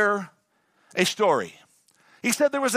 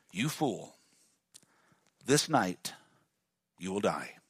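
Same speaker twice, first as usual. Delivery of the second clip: much lower and softer, grave God; 11 semitones lower, 7 dB quieter than usual. Acted. Preacher voicing God condemning the rich man, low and grave. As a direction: drop deep and quiet for God's verdict.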